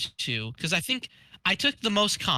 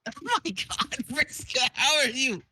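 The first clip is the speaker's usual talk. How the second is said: high excited greeting; 6 semitones higher than usual. Real, not acted. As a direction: gush at her, higher.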